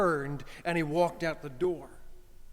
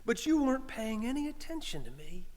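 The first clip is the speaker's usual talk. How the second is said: higher, exasperated scolding friend; light scold from a friend; voicing Eddie; 7 semitones higher than usual. Eddie scolding his friend for arguing, mildly.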